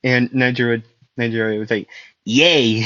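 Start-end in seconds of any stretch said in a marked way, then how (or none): 2.2-2.9 drawn-out yay into giggles; gleeful cheer. Acted. Nejire replying to her dad and sister, gleeful giggly cheer. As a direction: cheer happily, then giggle. Voicing a delighted daughter's reply.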